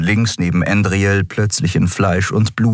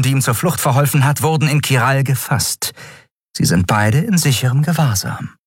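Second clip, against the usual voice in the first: higher-pitched, dutiful report to a master; subordinate's raised, submissive voice; deferential servant; 7 semitones higher than usual. Acted. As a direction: report respectfully to a feared ruler.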